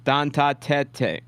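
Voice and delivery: "Don Ta Tete" slowly, ending teh-tay; two-syllable Tete. Shown as a demonstration. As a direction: sound out "Don Ta Tete" with a final "ay". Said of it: "is it Tete or Tet?".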